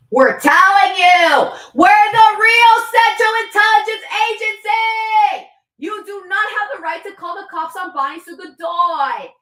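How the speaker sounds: high, loud half-sung proclamation; ranting sung shout. Real, not acted. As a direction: belt it like a proclamation. Ranting declaration.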